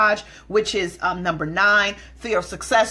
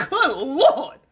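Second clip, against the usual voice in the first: high, laughing gossipy church lady; tut-tut about her in the pew, laughing; gossiping churchgoer; 5 semitones higher than usual. Retold or imitated. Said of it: "You like".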